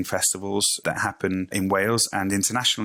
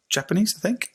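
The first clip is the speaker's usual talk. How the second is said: ordinary talk, nothing marked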